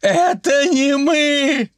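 high drawn-out wail; wailing protest. A narrator's reading. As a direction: howl it high, stretching the vowels. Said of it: "завыли хором мужики". (высокий протяжный вой)

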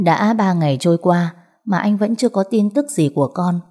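usual speaking voice

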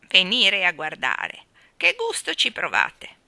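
put-on loud, slow, quavering old balloon seller; trembling old woman. Balloon woman chides the children, in a loud trembling old voice. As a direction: scold them like a frail old woman full of passion. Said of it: "La voce della vecchietta tremava".

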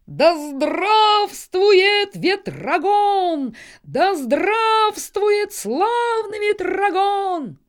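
very slow, high, loud crow cheer; cheering horde. A narrator's reading. Crow horde greets its chieftain, drawn-out high cheer. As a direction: hail your leader with stretched, rolling cries. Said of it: "приветствуя своего атамана".

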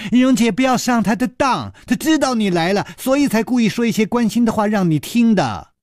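shouting down from the hold to warn her cousin; loud call. A narrator's reading. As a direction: call out loudly and urgently. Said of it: "只听宋田儿大声地说".

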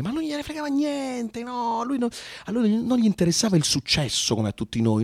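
ordinary talk

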